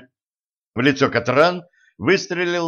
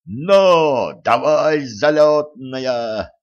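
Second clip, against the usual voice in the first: coachman's shout; 2 semitones higher, 5 dB louder, much slower than usual. A narrator's reading. Gnome fleeing by carriage, shouting the horse on. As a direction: holler the horse into motion, stretching the words.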